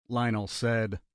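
ordinary delivery

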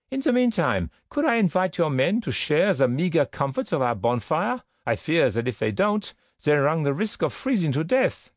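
high-pitched, courteous officer's invitation; formally polite, lilting high officer; SS captain, gracious. A narrator's reading. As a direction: play a captain offering hospitality, pitched well up. Narrator voices the SS captain.

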